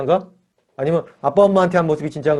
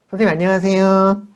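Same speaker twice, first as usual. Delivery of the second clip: put-on sweet polite child; 4 semitones higher than usual. Well-behaved child greeting the church teacher, sweetly polite. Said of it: "엄청 상냥하게 얘기를 잘해요".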